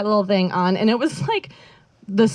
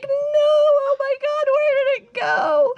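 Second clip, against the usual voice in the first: much higher, panicked cry; slower than usual. Retold or imitated. Girl finding her stash gone, panicked high voice. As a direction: squeal in mock panic at the missing thing. Thong gone from sock drawer.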